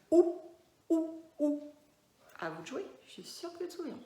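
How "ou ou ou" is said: loud "Ou" sounds; teacher isolating the "ou" sound. Shown as a demonstration. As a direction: call "Ou, ou, ou" clearly. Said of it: "deux sons, pareil".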